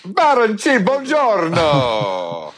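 high, slow hammed-up Italian accent. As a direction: stretch it out in a mock Italian accent. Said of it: "enjoy that Italian accent".

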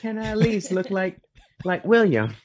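high-pitched, laughing complaint; amused protest. Real, not acted. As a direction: plead your case through the laughter. Mock protest at the comparison, amid laughter.

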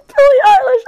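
very high, loud fangirl scream; piercing squeal of excitement; freaking out. Retold or imitated. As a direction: shriek the star's name in ecstasy. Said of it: "I was freaking out".

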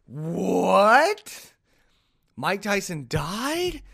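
drawn-out rising "what", incredulous; stunned double-take, voice climbing into a question; disbelieving and stretched out; shocked reaction. Retold or imitated. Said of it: "And I go".

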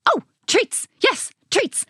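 put-on high, excited old scientist; lightly voiced dotty old man; eccentric old man. Old man answers door.

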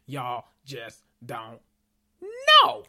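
she can't contain her excitement; excited outburst. Real, not acted. To viewers, about the comics: excited squeal.

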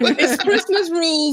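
laughing. Real, not acted. Laughing, slowly defending.

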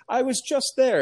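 very high, mock-frustrated comeback; exasperated retort. Lost driver to passerby, high exasperated protest. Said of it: "in my American accent".

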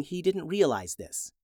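usual speaking voice

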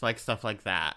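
ordinary talk

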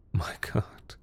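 breathless whisper of shock; horrified whisper. Acted. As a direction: whisper it, stunned by horror. Horrified thought in surgery.

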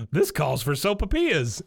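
mock thrilled proclamation; Bill. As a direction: gasp and declare it with delight. Acting out Bill's excited announcement.

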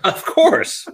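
laughing. Real, not acted. Host jokes podcast next step.

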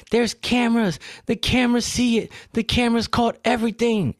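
high-pitched mock hysteria; hammed-up high, insistent claim; frantic accuser. Acts the frantic lady accusing.

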